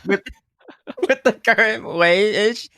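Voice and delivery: high-pitched, breaking up with laughter; real helpless giggles between words; laughing. Real, not acted. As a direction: try to finish the point while cracking up. Cracking up while making a point.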